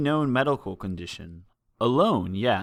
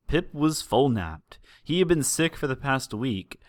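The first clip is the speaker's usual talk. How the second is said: plain reading voice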